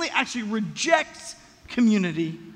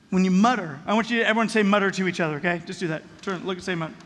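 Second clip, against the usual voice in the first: ordinary talk, nothing marked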